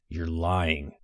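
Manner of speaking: low, tight through clenched teeth; through gritted teeth. A narrator's reading. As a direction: push it out with jaw clenched. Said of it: "Tay said through gritted teeth".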